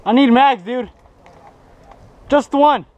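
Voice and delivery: loud, high plea for ammo; urgent shout. Real, not acted. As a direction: yell for a mag. Begging teammate for ammo.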